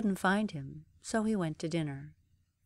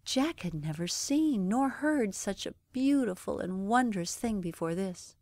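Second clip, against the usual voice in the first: ordinary delivery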